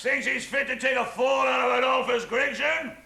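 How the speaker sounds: loud, high belligerent bellow; drunken shouting. Acted. Drunk husband roaring defiance at the house, loud and belligerent. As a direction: roar your defiance, drunk and swaggering.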